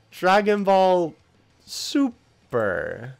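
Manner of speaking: slow and stretched, starting high and loud; drawn-out, baffled repeat. Real, not acted. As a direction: linger on each word, incredulous. Repeats the baffling title in disbelief.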